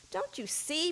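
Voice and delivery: high, pleading question. A narrator's reading. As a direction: beg them to understand. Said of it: "pleaded the sixth blind man".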